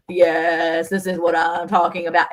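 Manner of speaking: imitating a voice shaken by treatment; mock vibrating voice; juddering voice. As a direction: talk as if your back is being drummed. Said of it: "du-du-du-du-du-du-du thing on my back".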